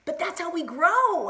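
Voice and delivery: slow, rising, drawn-out cry of excitement; elated exclamation. Real, not acted. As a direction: stretch it high, building towards a scream. Breakthrough moment, builds to scream.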